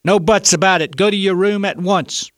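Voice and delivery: loud, high scolding order; stern mother brooking no argument; breaking in sharply. A narrator's reading. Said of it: "but the mother broke in".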